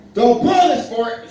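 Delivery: line yelled. Retold or imitated. In the live sketch, yelling the line at Will. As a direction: belt the line out. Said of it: "so I say my line".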